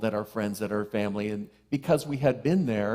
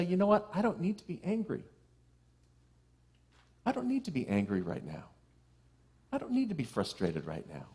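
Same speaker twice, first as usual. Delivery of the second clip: calming self-talk; 4 semitones higher, 5 dB quieter than usual. Retold or imitated. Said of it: "what helped me was just say".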